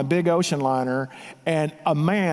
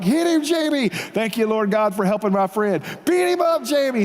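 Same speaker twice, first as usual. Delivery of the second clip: cheering on; 6 semitones higher than usual. Retold or imitated. Cheering friend on during fight.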